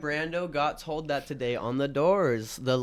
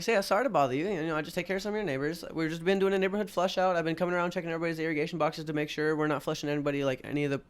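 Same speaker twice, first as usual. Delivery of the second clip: higher, fast overly friendly pitch; fake-polite salesman; 6 semitones higher, faster than usual. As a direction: rush the cover story sweetly. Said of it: "I'm like".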